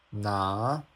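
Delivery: "拿" rising steadily as ná; second tone. Shown as a demonstration. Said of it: "the logic is all the same".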